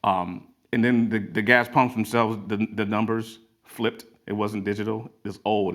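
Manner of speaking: ordinary talk, nothing marked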